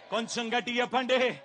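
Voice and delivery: ordinary talk, nothing marked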